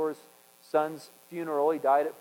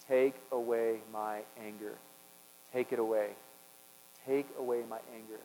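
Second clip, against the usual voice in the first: quiet prayer; 4 semitones lower, 7 dB quieter than usual. Retold or imitated. Prayer retold, asking God.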